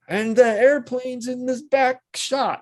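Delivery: high, slow, drawn-out pitch of a shot; stretched, high imitation of an enthusiastic director; excited director. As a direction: paint the shot grandly, way up high. Excited director describing a shot, voiced.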